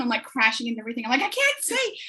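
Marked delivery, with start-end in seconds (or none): 1.2-2.0 high, lost little cry; mock panic. Mum crashing in Minecraft, high mock panic. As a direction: sound hopelessly lost in the game. Retold panic while gaming.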